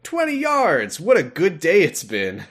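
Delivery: high-pitched mock elation; thrilled. Driver to wife after moving up slightly, thrilled. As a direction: celebrate a tiny gain as a huge win.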